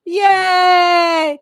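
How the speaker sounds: high, loud yay; cheer. Real, not acted. Grandma cheering her grandson's good news, loud yay. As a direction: cheer him on loudly.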